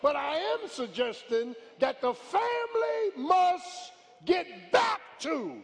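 slow, high, shouted sermon climax; full-force preaching. Real, not acted. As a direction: preach it out loud to the congregation.

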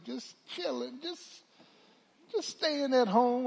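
high, casual evasive excuse; evasive fib. Retold or imitated. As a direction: play it cool while lying. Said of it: "I was lying".

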